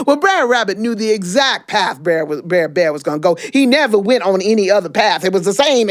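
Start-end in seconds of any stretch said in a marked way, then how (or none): none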